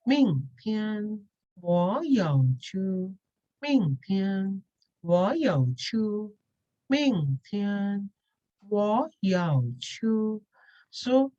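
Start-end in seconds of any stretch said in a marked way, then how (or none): 0.1-10.1 明天我要去 drawn out with exaggerated tone contours; slow drill, 明 rising, 天 held level, 去 falling; mastering the tones. Shown as a demonstration. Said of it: "you really need to master the tone".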